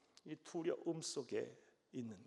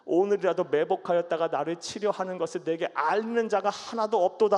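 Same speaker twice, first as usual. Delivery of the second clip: loud scripture reading; 2 semitones higher, 12 dB louder than usual. Acted. Preacher reading Saul's paranoid charge to his servants, loud. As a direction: proclaim the king's charge from the pulpit.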